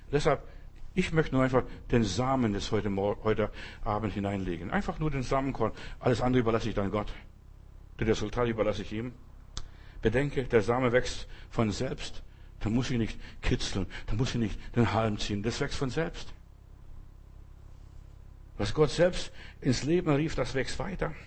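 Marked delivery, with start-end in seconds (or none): none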